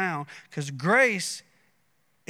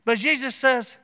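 rising preacher's cadence, a bit higher; building sermon; 4 semitones higher, 4 dB louder than usual. Acted. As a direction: preach it home with growing fervor.

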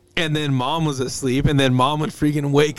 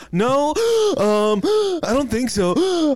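much higher, gasping pretend patient; mock breathless patient; 8 semitones higher than usual. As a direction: gasp between words like no air.

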